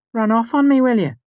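high, loud play-acted scolding; mock outrage. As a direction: act out a jealous rant. Mimicking Ambrose, hands on hips.

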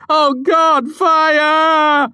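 high, loud, hammed-up cry; overacted shouted alarm. A narrator's reading. Basil overacting his part outside, shouted. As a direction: overplay the panic at full voice. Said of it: "listened to Basil overact his small part".

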